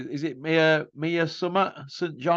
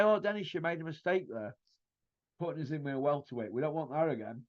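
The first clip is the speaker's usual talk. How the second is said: mock whining; 10 dB quieter, faster than usual. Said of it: "everything they said to Dennis".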